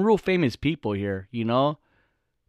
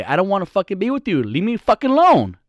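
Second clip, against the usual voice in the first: louder, higher at the end, leave me fucking alone; blunt brush-off; 6 semitones higher, 4 dB louder than usual. Retold or imitated. Telling a pushy guy at a bar to back off, rising to a near shout. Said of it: "you say".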